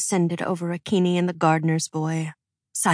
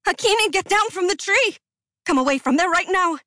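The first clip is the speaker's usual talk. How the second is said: frantic high shout; 12 semitones higher, faster than usual. Acted. Queen orders the girl down from the tree, frantic high shout. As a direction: yell at her to come down, fast. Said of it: "the queen screamed".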